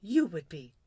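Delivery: firm little prince. A narrator's reading. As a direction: answer the monarch decidedly. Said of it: "said the little prince quite firmly".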